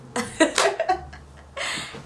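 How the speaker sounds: amused laugh at her own anger; high laugh. Real, not acted. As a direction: giggle at herself. Laughing at herself.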